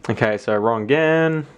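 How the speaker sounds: ordinary talk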